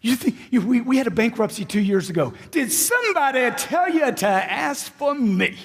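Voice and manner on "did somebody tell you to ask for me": higher, slower, punchy and full of energy; enthusiastic. Shown as a demonstration. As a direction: sell it with real enthusiasm.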